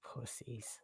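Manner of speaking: breathy whispered jab under the breath; whispered insult. Real, not acted. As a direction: mutter it as a hushed aside. No one takes the link.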